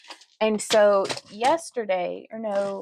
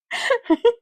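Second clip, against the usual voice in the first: high giggle; 5 dB louder than usual. Real, not acted. Laughs at own slip.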